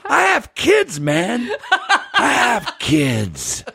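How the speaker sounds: high, laughing mock plea; hurt husband. Husband pleading about his kids. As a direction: ham up a wounded father. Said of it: "He was like".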